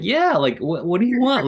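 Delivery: high mock-exasperated "what do you want?"; mock defiant. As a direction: toss it back as a teen shrugging off the parents. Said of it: "And I'm like".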